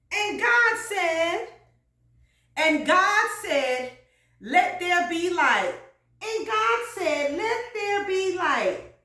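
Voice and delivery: high, loud preacher's cadence; fervent preaching. Acted. Preacher calling on congregation for a reader, fervent preaching. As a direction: draw out the scripture as if rousing a church. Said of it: "And when we preach, forget it".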